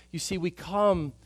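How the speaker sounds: normal talking voice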